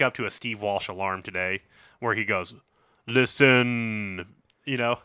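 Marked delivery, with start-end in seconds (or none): 3.1-5.0 a weak attempt at a British radio DJ accent; half-hearted British accent, slowing at the end; bad British accent. Imitating the DJ alarm in a weak British accent. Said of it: "I can't really do a British accent".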